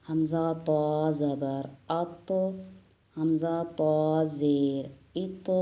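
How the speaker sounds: "हमज़ा, तो" drawn out slowly, "अत" with a bounce; slow spelling drill. Shown as a demonstration. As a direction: spell each letter slowly, end with an echoing "अत". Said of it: "double आवाज़ में". ("अत" और "इत" धीमे हिज्जे, गूँजती आवाज़ में)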